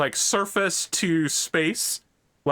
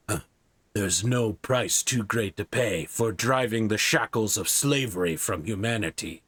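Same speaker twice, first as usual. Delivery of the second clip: bland, unassuming; 6 semitones lower than usual. Acted. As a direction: speak low and even, with no show. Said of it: "kind of bland and unassuming".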